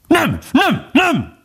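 very high, yelping dog voice; crying out. A narrator's reading. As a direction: yap it back in a piercing pitch. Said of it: "kiáltotta a kutya". (éles hangon kiáltva)

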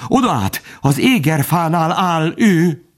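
high-pitched frightened report; panicked stammering. A narrator's reading. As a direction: voice pitched high in alarm, stretching the last words. (rémülten, magas hangon)

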